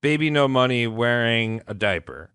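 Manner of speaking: ordinary talk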